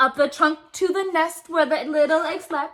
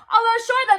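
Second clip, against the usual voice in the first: loud, higher call; men's shout; 5 semitones higher, 5 dB louder than usual. A narrator's reading. Men shout as the ship docks in New York. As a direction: call it out like sailors landing. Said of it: "the men shouted".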